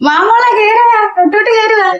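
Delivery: high, gushing, put-on sweet welcome; sugary welcome. Aunt welcomes the neighbour's daughter in a high gushing voice. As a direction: lay the sweetness on thick. Aunt greets the young girl.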